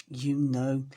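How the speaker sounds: plain reading voice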